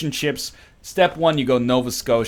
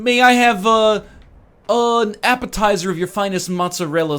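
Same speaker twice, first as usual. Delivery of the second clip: over-formal, higher-pitched diner; mock-polite customer; 5 semitones higher, 4 dB louder than usual. Ordering mozzarella sticks from a sir, absurdly polite. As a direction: order with elaborate, hammed-up courtesy. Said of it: "Can you please just say straight up mozzarella".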